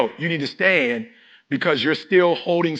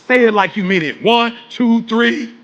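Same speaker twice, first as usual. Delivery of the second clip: ordinary talk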